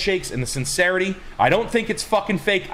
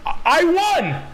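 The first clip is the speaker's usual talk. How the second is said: triumphant cry; 12 semitones higher, slower than usual. Real, not acted. Triumphant outburst about helping someone.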